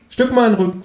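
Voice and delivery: high-pitched talking kangaroo; high cartoon animal voice. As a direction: squeak it out as a bossy kangaroo. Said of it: "sagt das Känguru".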